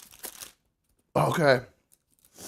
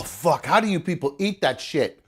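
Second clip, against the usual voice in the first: ordinary delivery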